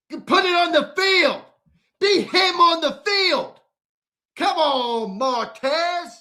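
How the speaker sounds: angry shout. Real, not acted. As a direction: bark the demand at him. Host demands proof on field.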